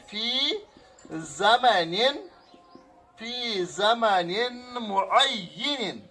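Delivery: very slow, each syllable of فی زمن معین drawn out; slow word by word. Shown as a demonstration. Teacher spelling out an Arabic phrase for students, very slow and syllable by syllable. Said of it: "یہ بات اہم ہے".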